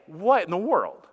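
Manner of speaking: alarmed protest. Retold or imitated. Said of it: "And I'm like".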